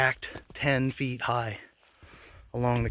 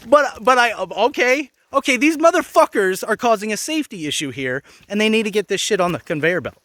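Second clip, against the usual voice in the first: heated loud protest; 6 semitones higher, 5 dB louder than usual. Retold or imitated. Heated argument with supervisor.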